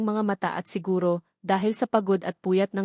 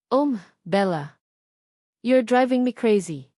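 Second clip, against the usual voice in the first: lover's voice; 3 semitones higher, 5 dB louder, slower than usual. Acted. Kenzo speaking during sex.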